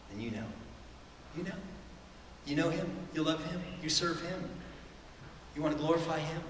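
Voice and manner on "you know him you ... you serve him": normal talking voice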